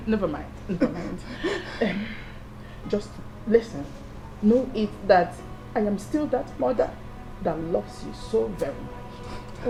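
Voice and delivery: soft, low, tender voice; gentle reassurance. Acted. Mother assuring crying wife of her love, gentle reassurance. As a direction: soothe someone who has been crying.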